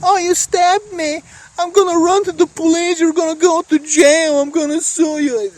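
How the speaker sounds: stabbed stranger whining about police and suing; whiny victim. Retold or imitated. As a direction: play the wounded guy crying to the cops. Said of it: "Now it's like".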